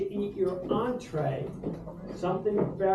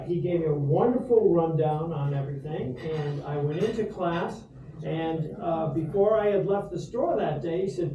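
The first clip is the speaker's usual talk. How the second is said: normal talking voice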